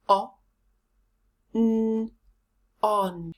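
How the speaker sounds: O, n, on spelled out sound by sound, then blended; sounding out the first syllable. Shown as a demonstration. Teacher to learners, building "on" sound by sound. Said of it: "First syllable, silent H".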